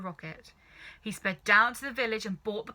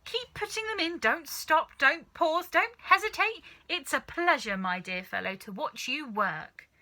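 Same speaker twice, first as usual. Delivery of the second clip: high and loud, gleeful, easing off at the end; excited urging; 6 semitones higher, 4 dB louder than usual. Acted. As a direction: cheer him on while dancing about. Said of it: "cried Mister Cranky, dancing around the kitchen".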